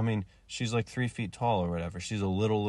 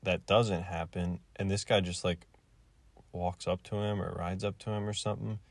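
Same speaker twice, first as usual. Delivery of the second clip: usual speaking voice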